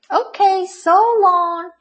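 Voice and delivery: high, loud sing-out farewell; cheerful goodbye. A narrator's reading. Tumbleweed leaving the grumpy cactus, cheerful. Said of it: "said Rosie cheerfully".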